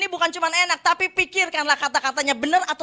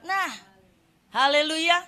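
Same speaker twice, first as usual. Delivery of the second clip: ordinary talk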